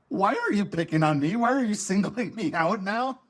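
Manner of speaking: mock plaintive complaint, pitched high; indignant protest. Retold protest at being singled out.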